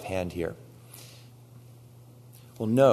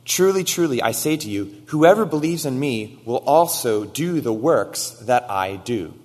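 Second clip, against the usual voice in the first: higher, louder solemn proclamation; proclaiming scripture; 5 semitones higher, 7 dB louder than usual. Acted. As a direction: preach the verse with weight.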